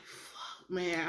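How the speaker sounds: a near-whispered fuck, man; muttered curse. Retold or imitated. Muttering to herself after hearing she may have corona, quietly dismayed. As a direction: swear under the breath in dread.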